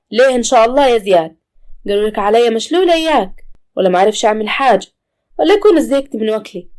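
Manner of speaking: a proud mother insulted by the help; loud wounded pride of an old mother; offended old mother. Acted.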